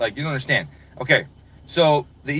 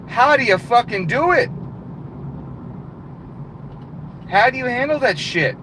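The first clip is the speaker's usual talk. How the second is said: higher, exasperated mock demand; mock outraged rant; 9 semitones higher than usual. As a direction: pretend to confront the boss, higher and heated. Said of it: "I'm gonna say".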